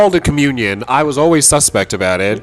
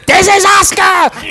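high, loud, slow shout; high shouted Asuka greeting; 6 dB louder, slower than usual. Retold or imitated. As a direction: yell her name into the phone. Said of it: "answer the phone".

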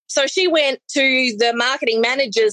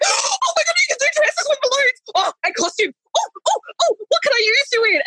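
very high, squealing amazement; thrilled squeal; 13 semitones higher than usual. Retold or imitated. Manager discovering balloon dresses, squealing high with amazement. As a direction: gasp out each word in wonder. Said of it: "She's like gasps".

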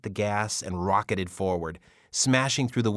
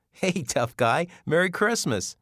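put-on higher, bright greeting to a little boy; cheery voice for a child; 9 semitones higher, 4 dB louder than usual. Mitch greets little Jacob with a cheery lift. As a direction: hail the kid playfully.

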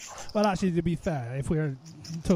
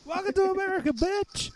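much higher mocking old-timer voice; old prospector. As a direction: sneer it like a cranky geezer. Voicing hostile local to child.

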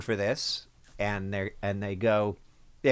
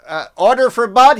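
high, loud lost delivery guy; bewildered guy walking into the wrong room; regular delivery guy; 12 semitones higher, 12 dB louder than usual. Retold or imitated. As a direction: call out like a confused delivery man. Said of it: "he's like".

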